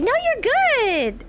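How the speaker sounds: high slow reassuring. Real, not acted. Streamer to a viewer who fell asleep, reassuring. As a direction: tell the viewer it's fine.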